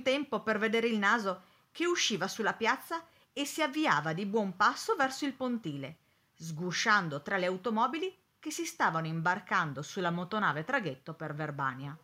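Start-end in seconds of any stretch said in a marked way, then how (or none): none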